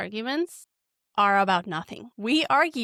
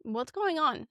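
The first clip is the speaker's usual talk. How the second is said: softer, higher, tender asking; gentle loving question; 3 semitones higher, 5 dB quieter, slower than usual. Retold or imitated. Asking husband gently what's going on, soft and tender. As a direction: gently coax him to open up. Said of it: "gentle, loving question".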